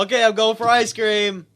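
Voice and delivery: loud, high mock declaration; imitated announcement. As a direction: call it out as a pretend exit line. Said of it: "All he had to do was go".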